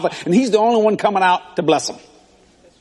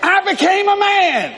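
preaching shout; 10 semitones higher than usual. Real, not acted. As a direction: bellow the punchline to the church. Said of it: "preacher's punchline shouted".

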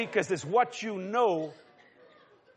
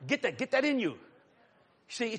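ordinary talk, nothing marked

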